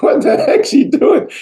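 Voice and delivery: high-pitched imitation of laughing players. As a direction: quote the baffled players through laughter. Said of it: "they're all laughing".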